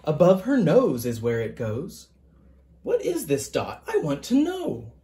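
gentle picture-book reading, even and measured; story narration. A narrator's reading. Author reading his picture book to listeners, storytelling voice. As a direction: read it aloud to a class of children.

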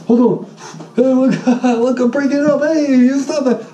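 high, hammed-up shouting while filming; mock yell. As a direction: play the hero for the camera. Pretend filming while breaking fight.

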